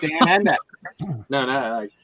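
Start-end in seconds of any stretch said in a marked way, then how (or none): 0.0-0.5 put-on Australian accent. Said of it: "pull off a perfect Australian accent".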